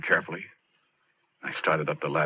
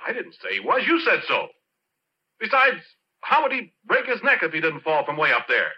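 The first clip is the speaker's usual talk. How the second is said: high, louder, heated reasoning; defensive argument; 11 semitones higher, 4 dB louder than usual. Acted. Porky arguing with the cop, heated and defensive. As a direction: argue his case loudly.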